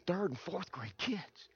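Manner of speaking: very soft, slow question; hushed, rising, taken aback; dismayed echo. Retold or imitated. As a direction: echo it back in quiet disbelief. His shy, dismayed answer.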